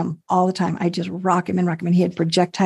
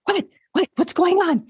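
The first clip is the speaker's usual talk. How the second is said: alarmed nurse; 10 semitones higher than usual. Retold or imitated. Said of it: "she's like".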